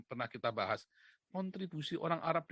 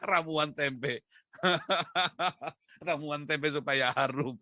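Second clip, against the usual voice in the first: loud cackling laughter between phrases; real laughing; 5 dB louder, slower than usual. Real, not acted. Speaker laughing hard at his own tempe pun. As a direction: crack up at your own joke.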